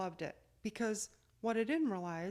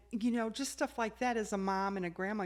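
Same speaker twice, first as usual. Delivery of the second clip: ordinary delivery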